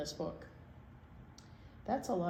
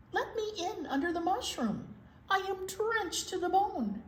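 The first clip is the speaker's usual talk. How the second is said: little mouse voice; 8 semitones higher than usual. Acted. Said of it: "A mouse ran up".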